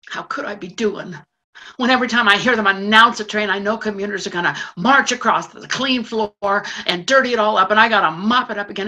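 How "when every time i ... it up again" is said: loud, fast angry rant; building anger. Acted. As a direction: spit out the frustration. Furious rant about commuters.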